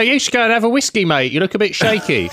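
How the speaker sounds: mock taxi driver voice; taxi driver impression. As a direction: imitate a cabbie giving matey advice. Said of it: "taxi driver. That's how they all talk".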